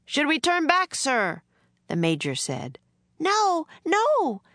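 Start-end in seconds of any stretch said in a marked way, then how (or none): 0.1-1.4 much higher, urgent soldier; a worried officer pressing his general; anxious major. A narrator's reading. Major asks the general about turning back, anxious. 3.2-4.4 put-on high, louder girl's cry; girl's urgent cry. A narrator's reading. Girl protests in the boat, urgent cry. Annie cries out.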